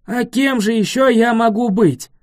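loud, high booming roar; dragon roar. A narrator's reading. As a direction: roar it out indignantly. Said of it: "взревел дракон".

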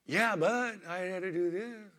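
mock whining self to God; whiny excuse. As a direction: whine back at God like a caught kid. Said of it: "the Lord just spanked me and said".

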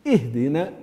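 "اهدنا" opened on a clear i, "ihdina"; hamza with kasra. Shown as a demonstration. Teacher modelling the hamza rule, "ih" opening. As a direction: start "اهدنا" with a pure "ih". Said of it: "مكسورة إي".

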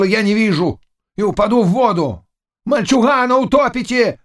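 high-pitched ranting yell; shouting and cursing. A narrator's reading. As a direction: curse the crowd out as you shove through. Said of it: "Крича, ругаясь".

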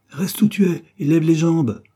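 loud, high-pitched yelled command; vociferating. A narrator's reading. Wife bawling at husband to stay, loud yell. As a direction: bellow it at him, pitch high. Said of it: "vociféra la dame".

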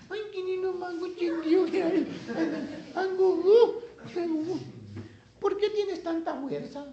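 very high falsetto cooing; mock wheedling girlfriend in a squeaky high voice; coaxing baby talk. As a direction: coo sweetly as if to a baby. Dalila babying Sansón to coax him.

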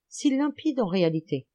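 plain reading voice

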